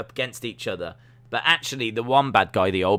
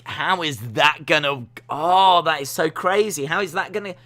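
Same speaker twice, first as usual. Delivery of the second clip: higher and louder, breathless delight; hooked fan; 7 semitones higher, 5 dB louder than usual. Retold or imitated. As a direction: gasp it like you can't wait. Imitating hooked wrestling fan.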